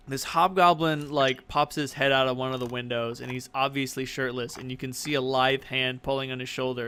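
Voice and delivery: ordinary talk, nothing marked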